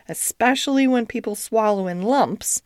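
usual speaking voice

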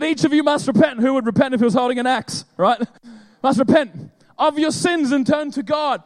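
loud, driving preacher delivery; preaching loud. Real, not acted. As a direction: hammer it home to the crowd. Preaching punchline at full force.

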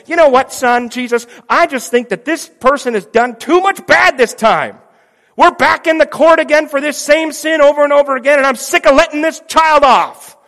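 high, loud exasperated refusal; a father who has had enough; fed-up father. Pretend. The Father refuses Jesus, loud and fed up.

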